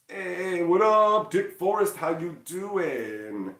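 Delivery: high, drawn-out hello; hearty greeting. Real, not acted. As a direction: call out to a viewer, stretching the last word. Calling out greeting to a viewer.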